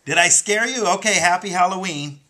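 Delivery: high and loud, teasing after a scare; giddy after jumping out at them; playful excited. Pretend. Halloween prank on viewers.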